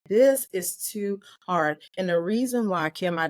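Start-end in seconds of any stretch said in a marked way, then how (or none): 0.1-1.8 slow, strained repeat of the plea, mildly retold; a retold, exhausted prayer, slow; weary plea to God. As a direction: say it like you are worn out. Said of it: "I started talking to God".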